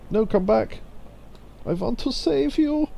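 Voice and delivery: put-on a jokey high German accent, drawn out. Streamer playing the hero, in a mock German accent. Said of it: "I did a German accent there".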